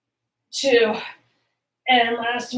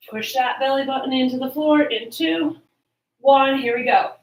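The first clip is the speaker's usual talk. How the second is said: aerobics instructor. Acted. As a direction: pump up the class on the count-in. Instructor cueing with rising energy.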